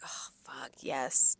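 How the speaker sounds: retold whispered oh-no reaction; hushed dismay. Server realising the guests got bacon, hushed dismay. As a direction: let it sink out under your breath.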